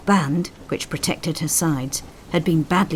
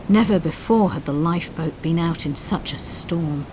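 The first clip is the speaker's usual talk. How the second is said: usual speaking voice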